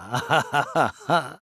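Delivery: villain's self-satisfied laugh; gloating cackle. Acted. Scientist keeping his secret from the group, gloating cackle.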